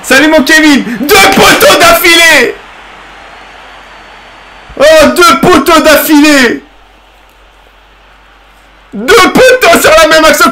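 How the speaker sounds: high, loud disbelieving yell, laughing; cri d'excitation. Real, not acted. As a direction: shout it out in joyful disbelief. Hurle après deux poteaux. (crie, aigu et fort, incrédule et hilare)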